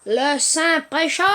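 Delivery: loud, slow grand announcement; mock-solemn proclamation. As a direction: proclaim it loudly, drawn out. Proclame le pré-shot, solennel. (proclamation solennelle et forte)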